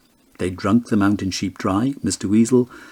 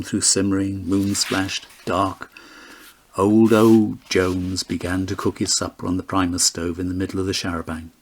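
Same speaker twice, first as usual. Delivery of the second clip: ordinary delivery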